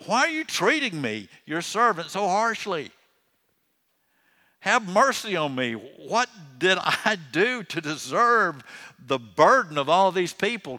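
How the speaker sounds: high, loud acted exasperation; very aggravated. Preacher voices Moses protesting to the Lord, very aggravated. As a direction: plead in aggravated protest, pitch soaring.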